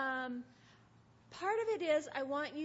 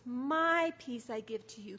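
loud, stretched "My", the rest running on evenly; stress on My; 6 dB louder than usual. Shown as a demonstration. Pointing listeners to the pronoun for Jesus, stress on "My". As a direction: punch "My" and flow through the rest. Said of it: "It's the personal pronoun for Jesus".

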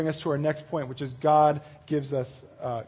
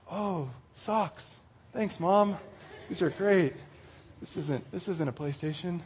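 softer, deflated fake gratitude; mock disappointment; 8 dB quieter, slower than usual. Kid opening socks on Christmas morning, quietly disappointed. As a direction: let the hope drain into quiet letdown. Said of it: "gotten a gift that you never really wanted".

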